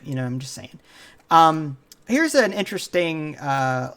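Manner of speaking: usual speaking voice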